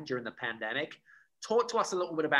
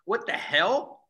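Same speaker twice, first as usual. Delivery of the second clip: mock surprise; 7 semitones higher, 7 dB louder, slower than usual. Real, not acted. Playful surprise in interview.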